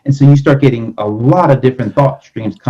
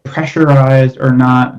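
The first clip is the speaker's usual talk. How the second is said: usual speaking voice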